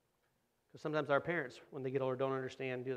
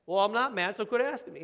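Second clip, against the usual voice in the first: mock irritated retort; 7 semitones higher, 8 dB louder than usual. Retold irritated argument.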